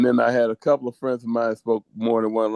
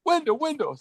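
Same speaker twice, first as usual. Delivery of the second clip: put-on much higher, eager child calling out; a small kid pointing, high and thrilled; father retells daughter reading a sign, high excited child voice; excited little girl; 12 semitones higher than usual. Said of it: "all of a sudden".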